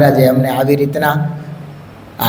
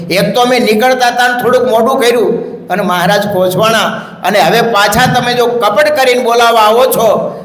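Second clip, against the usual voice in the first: higher, anxious pleading; worried sister; 6 semitones higher than usual. Acted. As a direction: fret over breaking the master's word. Sister fearing broken vow.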